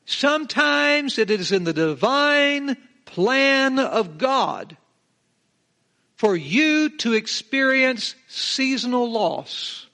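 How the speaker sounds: high, loud, slow proclamation; preaching at full force. Real, not acted. Preacher to his congregation, slow ringing high proclamation. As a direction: preach it out to the back pews.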